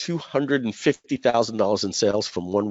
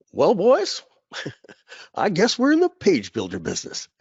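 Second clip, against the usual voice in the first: put-on much higher, fond voice of an old partner, laughing through it; Andy Jenkins impression; 7 semitones higher than usual. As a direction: play the late partner's warm, amused line, pitched well up. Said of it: "I'll do my best Andy Jenkins impression".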